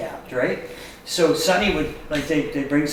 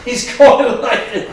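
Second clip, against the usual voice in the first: ordinary delivery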